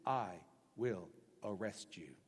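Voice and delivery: low, slow, stern warning; stern threat. Retold or imitated. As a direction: deliver it slow and low like a governor. Nehemiah threatening merchants.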